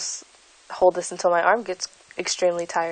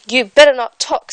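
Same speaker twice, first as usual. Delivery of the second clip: snapping back; 4 semitones higher, 8 dB louder than usual. Acted. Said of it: "said Five".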